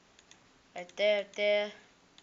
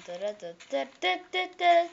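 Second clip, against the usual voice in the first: usual speaking voice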